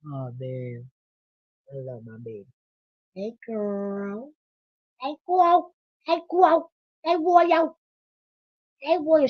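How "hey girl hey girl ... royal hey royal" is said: much higher, louder sing-song calls to the dog; high pet call. Real, not acted. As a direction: chirp at the dog to get its attention.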